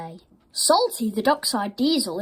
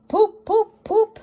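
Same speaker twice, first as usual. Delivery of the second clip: high, loud poop poop; engine whistle; 12 semitones higher, 6 dB louder than usual. A narrator's reading. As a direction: mimic a proud engine whistle. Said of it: "makes sure to tell Henry".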